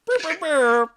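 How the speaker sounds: loud, high honking blasts; air horn noise. Pretend. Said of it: "School should have more air horns".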